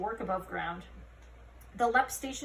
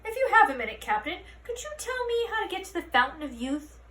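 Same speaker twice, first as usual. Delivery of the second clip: put-on high, louder quavering old voice; hopeful tourist; 9 semitones higher, 4 dB louder than usual. A narrator's reading. An old tourist asking Holly the way. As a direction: ask eagerly for directions.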